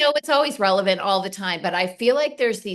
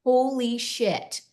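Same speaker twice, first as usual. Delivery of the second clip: awed; 2 semitones higher, slower than usual. Retold or imitated. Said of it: "all of a sudden I'm like".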